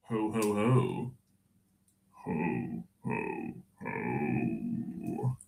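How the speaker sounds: low, slow and soft drawn-out ho-ho-ho; sinking ho-ho-ho. Acted. Same joke repeated ever deeper.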